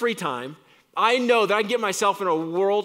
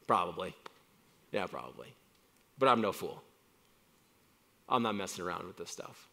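softer, lower, humble reply; hushed, humble admission; quiet admission; 10 semitones lower, 8 dB quieter, faster than usual. Retold or imitated. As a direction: concede it softly, then firm up. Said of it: "which I would say".

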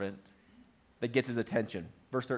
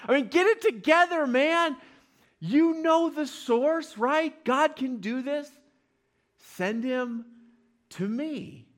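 high-pitched, louder exasperated scolding of the king; exasperated prophet; 9 semitones higher, 4 dB louder, slower than usual. Retold or imitated. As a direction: throw up your hands, get it together man. Preacher voices Elisha's exasperated message.

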